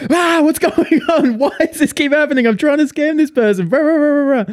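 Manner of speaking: high, loud frantic rant in mock outrage; hammed-up exasperated meltdown; losing it. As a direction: wail like a scammer losing it, trailing into gibberish. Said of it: "fucking losing it".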